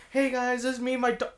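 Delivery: girl starting her dog video, mock influencer cheer; vlogger intro. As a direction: imitate a chirpy influencer opening. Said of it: "she's going like".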